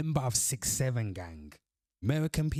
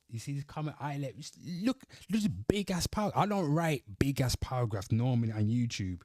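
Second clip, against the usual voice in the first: ordinary talk, nothing marked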